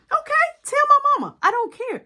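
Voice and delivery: put-on girlfriend's high shrieking retort, fast and loud; high fast yell. Girlfriend yelling back in the street, high fast shout.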